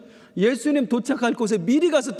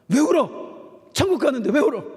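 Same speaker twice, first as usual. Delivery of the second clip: imitating a stern old deaconess, higher and louder; stern scolding; 7 semitones higher, 3 dB louder than usual. Pastor imitates a deaconess scolding mourners at a funeral, high and loud. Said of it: "무서운 분 있어요".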